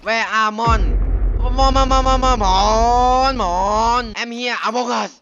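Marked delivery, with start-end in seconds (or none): 0.1-4.0 loud, slow drawn-out calling of มอน; stretched loud taunting calls. Real, not acted. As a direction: call the monster out across the room, slowly. Calling out to the monster.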